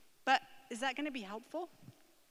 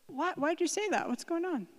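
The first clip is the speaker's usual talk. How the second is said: higher, louder concerned parent asking; puzzled questioning that invites the child to explain; curious; 6 semitones higher, 5 dB louder than usual. Acted. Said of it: "Now a response in the situation".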